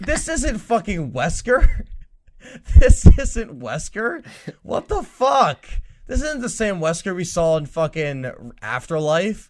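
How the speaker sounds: slow, loud, incredulous outburst; outraged disbelief. Retold or imitated. Ranting to a friend about a ruined character, slow outraged disbelief. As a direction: rant at the screen in disbelief, high and slow. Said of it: "I'm like".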